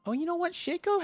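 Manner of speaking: sudden high surprise; surprised. Real, not acted. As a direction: jump up in pitch, caught off guard. Sudden realization mid-read.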